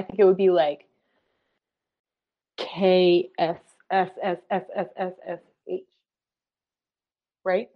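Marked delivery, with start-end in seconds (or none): 2.6-5.8 hissing crunch of breaking glass; glass breaking sound. Shown as a demonstration. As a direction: make a window shattering noise. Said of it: "How would you do it?".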